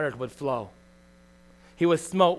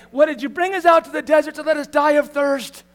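complaining Israelites; 9 semitones higher, 5 dB louder than usual. Retold or imitated.

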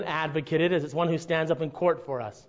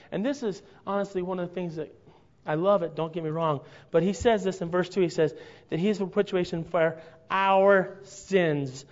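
ordinary talk, nothing marked

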